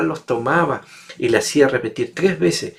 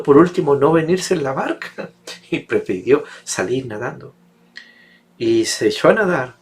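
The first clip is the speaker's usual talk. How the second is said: usual speaking voice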